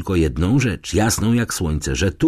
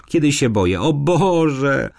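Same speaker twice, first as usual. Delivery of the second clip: frightened; 7 semitones higher than usual. A narrator's reading. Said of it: "Drżała jak w febrze".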